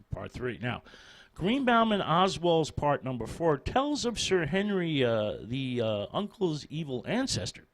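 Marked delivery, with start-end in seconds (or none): none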